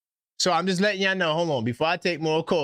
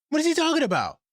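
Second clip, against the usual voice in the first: high, stunned what is he talking about; incredulous; 13 semitones higher, faster than usual. Real, not acted. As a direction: blurt it out in disbelief. Incredulous outburst at caller.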